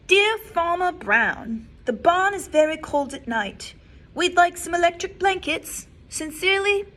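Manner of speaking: cows' letter. Acted. Librarian voices the cows' letter to Farmer Brown. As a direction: read it like the cheeky cows. Cows' note read aloud.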